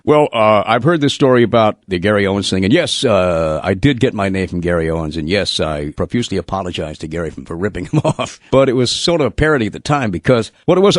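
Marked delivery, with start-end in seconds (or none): none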